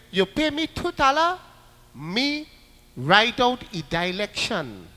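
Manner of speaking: sly vendor asking for money in mock Chinese-Trinidadian; Chinese-Trinidadian accent. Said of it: "they talk in a very funny Trinidad accent".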